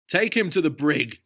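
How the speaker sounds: high, loud outraged voice; Notley exclaiming at Sam; indignant exclamation. A narrator's reading. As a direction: huff with offended dignity. Notley ordering arrest.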